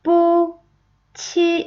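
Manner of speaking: 뿌치 slowly, high and level; toneless pronunciation. Shown as a demonstration. Teacher showing sounds before tones, 뿌치 high and level. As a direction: sound out 뿌치 syllable by syllable. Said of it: "먼저 발음 먼저 볼게요".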